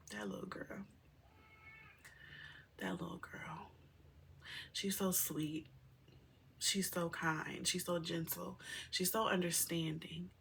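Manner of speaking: near-whisper, full of feeling; soft and halting, overcome with tenderness; hushed, moved. Real, not acted. Mother moved by her little girl's kindness, real hushed murmur.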